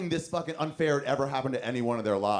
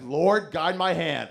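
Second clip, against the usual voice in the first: mock prayer; 3 semitones higher, 4 dB louder, much slower than usual. Mock prayer mid-throw.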